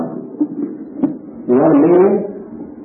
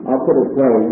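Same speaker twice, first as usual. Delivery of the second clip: ordinary talk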